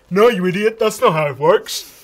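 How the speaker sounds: put-on outraged know-it-all; irate user voice. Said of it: "why does every user sound the same".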